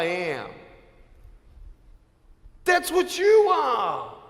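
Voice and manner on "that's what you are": high ringing shout at the bullies. Real, not acted. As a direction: call them out at full voice. Motivational speaker confronting bullies.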